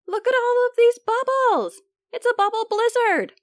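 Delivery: high, loud amazed cry; excited squeaky voice. Acted. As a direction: gasp at it like a delighted germ. Germ marvels at bubbles.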